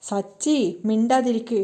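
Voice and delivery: plain reading voice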